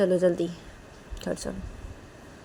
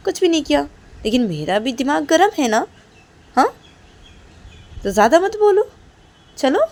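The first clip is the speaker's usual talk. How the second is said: higher, louder irritated father's outburst; angry father; 7 semitones higher, 6 dB louder than usual. Acted. Father, annoyed, tells mother to stop talking, rising angry shout. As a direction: snap back, temper rising to a shout.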